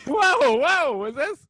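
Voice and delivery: loud high disbelieving whoa; stunned whoa. Real, not acted. Friend's claim sparks a loud high stunned whoa. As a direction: cry out in disbelief.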